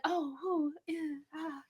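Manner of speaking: soft, airy dazed little moan; mock faint moan. Describing the heroine waking, a faint dazed moan. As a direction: mimic a woozy half-awake sigh. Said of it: "now you're like".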